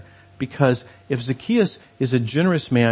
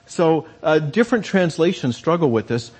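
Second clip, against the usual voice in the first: usual speaking voice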